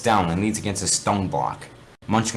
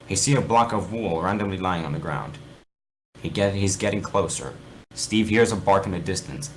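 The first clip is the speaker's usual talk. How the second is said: plain reading voice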